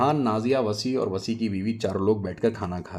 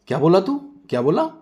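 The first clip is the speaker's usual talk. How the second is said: loud high demand; wife confronting the driver, loud and angry; angry confrontation; 8 semitones higher, 7 dB louder than usual. Acted. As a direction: challenge him hotly.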